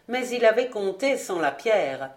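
plain reading voice

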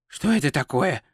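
mild, hushed alarm; understated worried question. Acted. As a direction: ask uneasily under the breath. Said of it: "встревоженно спросил Ринсвинд".

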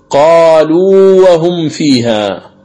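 قالوا وهم فيها in slow recitation, the ū of قالوا held before وهم; madd al-tamkin. Shown as a demonstration. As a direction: draw out the end of قالوا, keeping its waw apart from the next. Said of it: "بين الواوين أو الياءين".

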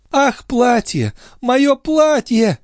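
high-pitched, slow lament; grief-stricken cry, pitched high; woeful exclamation. A narrator's reading. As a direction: wail it in distress, drawing out the words. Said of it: "горестно воскликнула госпожа Дюбари".

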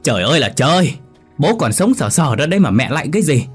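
exasperated shout. Acted. Son yelling at his confused mother, exasperated shout. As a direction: shout to snap her out of it. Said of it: "Thằng Ất hét lên".

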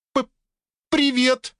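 stammered, very high little greeting; high-pitched timid stutter; startled squeak. A narrator's reading. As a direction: squeak out a hello, tripping on the first sound. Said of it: "пискнула Биониэль".

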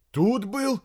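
high-pitched surprise; astonished. A narrator's reading. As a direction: answer in amazement, voice jumping up. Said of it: "изумился лейтенант". (изумлённо)

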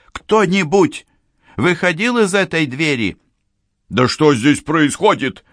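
high, loud urgent questioning; shouted questions. A narrator's reading. Policewoman demanding answers from the cleaner, shouted questions. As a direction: call out sharply, pitched well up. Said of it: "крикнула Францискович".

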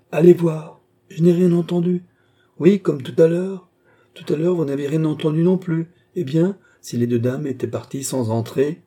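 high, loud, insistent back-and-forth; insistent bickering. A narrator's reading. Countess and servant argue about knocking.

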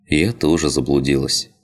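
usual speaking voice

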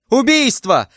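very high cry of alarm; alarmed shout. A narrator's reading. Servant sees his master stabbed and shouts in alarm. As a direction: raise the alarm. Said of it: "закричал Патрик".

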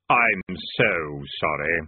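low, slow, hollow Death voice; grim reaper voice. A narrator's reading. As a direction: speak slow and deep as Death. Death speaks.

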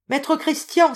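high, loud hail; exclaimed call. A narrator's reading. Visitor hailing his friend on arrival, exclaimed call. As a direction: call out the name to get attention. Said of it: "s'écria-t-il".

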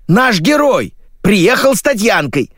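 high, flustered insistence; panicking. A narrator's reading. Editor explains who the guest is, high panicky voice. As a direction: explain in rising alarm. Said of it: "запаниковала редактор".

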